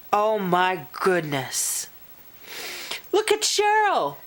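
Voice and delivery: ordinary delivery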